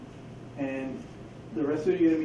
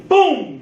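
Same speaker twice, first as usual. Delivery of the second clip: a sudden loud higher boom; mock gunshot boom; 9 semitones higher, 10 dB louder than usual. Instructor showing the hostage taker firing: loud mock boom. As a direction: punch out a gunshot sound. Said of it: "acting out shooting the liar".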